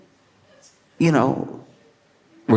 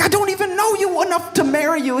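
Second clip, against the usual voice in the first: very high, incredulous protest; exasperated refusal; faster than usual. Acted. Woman refusing a surprise groom, acted high exasperated protest. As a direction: refuse in squealing mock exasperation.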